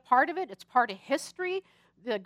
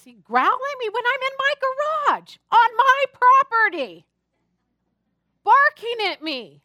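heated complaint; 9 semitones higher, 6 dB louder than usual. Retold or imitated. Complaining about neighbor's dog.

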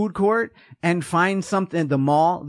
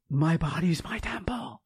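breathy unvoiced whisper, softer; mock whisper; 6 dB quieter than usual. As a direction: whisper it like a sacred secret. Sarcastic echo of pious line.

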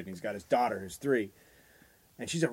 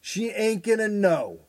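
higher, slow, louder emphatic; mock fanatical dad; 10 semitones higher, 6 dB louder than usual. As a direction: preach the tough-love rule. Voicing crazy dad's logic.

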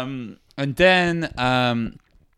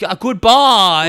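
higher, louder, drawn-out German farewell; Hans; 4 semitones higher, 6 dB louder, slower than usual. Acted. German guy driving off with all the money, sing-out German accent. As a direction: call out slowly in a German accent. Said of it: "And Hans is there smoking crack".

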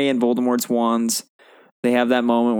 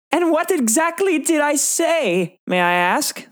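put-on much higher, slow prim girl's voice; high Hermione voice; slower than usual. Reciting Hermione's reply to a friend in a high prim voice. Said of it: "It's Hermione".

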